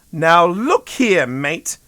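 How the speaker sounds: slow, loud Australian warning; stern Aussie. A narrator's reading. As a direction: put him in his place, slowly. Eliza had reached her fill.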